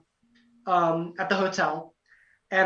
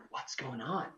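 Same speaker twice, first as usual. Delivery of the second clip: low, barely voiced question; hushed confusion; 7 semitones lower, 15 dB quieter than usual. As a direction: imitate puzzled onlookers murmuring very softly. Retells contestants' puzzled reaction.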